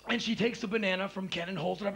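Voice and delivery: ordinary delivery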